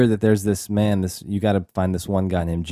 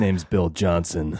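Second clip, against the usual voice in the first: low, slow gruff put-on tough-guy voice; Bill Johnson impression; 4 semitones lower, 4 dB quieter, slower than usual. Podcast host mimics the riverboat captain, low and slow. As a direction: growl it out like an overacting boat captain. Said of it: "He talks this way the entire movie".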